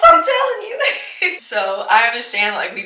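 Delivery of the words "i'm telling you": much higher, louder, laughing as she insists; real giggling emphasis; laughing insistence. Real, not acted. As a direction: laugh while driving the point home.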